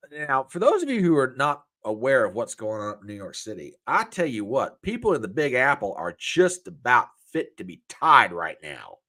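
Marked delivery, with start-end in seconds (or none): none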